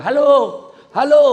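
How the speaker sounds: loud, high shout into the receiver; phone shout. Retold or imitated. Rich man yelling into the wrong end of the phone, loud. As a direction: yell halo down the line. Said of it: "Teriaklah dia".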